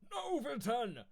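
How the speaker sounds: high, loud anguished shout; a loud hammed-up cry of protest; desperate cry. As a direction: yell it as she's taken away. Broly protesting the handover.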